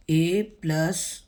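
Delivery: normal talking voice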